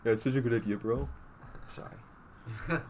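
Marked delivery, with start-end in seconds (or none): 1.7-2.8 whispered apology. Real, not acted. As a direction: breathe it out.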